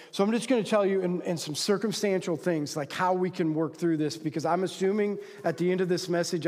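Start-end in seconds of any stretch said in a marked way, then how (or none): none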